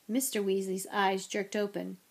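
ordinary delivery

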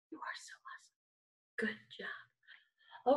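soft whispered praise. Acted. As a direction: whisper it like a secret.

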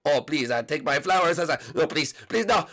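very low, deep put-on voice; pleading fan. Last fan offering Peach flowers, low pleading. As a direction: beg in a deep character voice.

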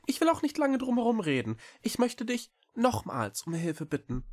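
very high, quick small-creature voice; little cat Echo, earnest. A narrator's reading. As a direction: plead eagerly in a high, fast voice. Cat asks for help. (hohe, eilige Katerstimme)

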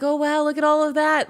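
high, loud mock amazement; imitating nervous awe. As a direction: act out a forced, nervous wow. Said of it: "just like this".

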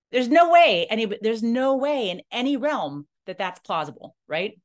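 high, loud disbelief; incredulous protest. Retold or imitated. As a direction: blurt it out in disbelief. Retold protest, pitch jump.

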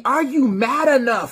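high, slow challenge called out; rousing challenge. Acted. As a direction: throw the question at the crowd.

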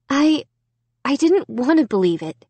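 confessing. A narrator's reading. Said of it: "she confessed".

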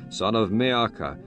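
usual speaking voice

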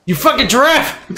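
shouting. Pretend. Yelling at a giraffe in game.

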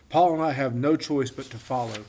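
ordinary delivery, nothing marked

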